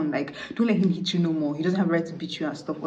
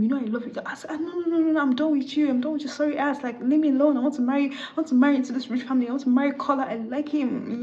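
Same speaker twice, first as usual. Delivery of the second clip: high-pitched acted brush-off; exasperated rejection; 8 semitones higher than usual. Bride brushing off her ex at the wedding, exasperated rejection. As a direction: rattle off a high, dismissive refusal.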